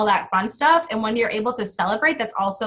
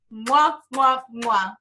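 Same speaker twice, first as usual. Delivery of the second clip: blown kisses; slower than usual. Real, not acted. Blowing kisses goodbye.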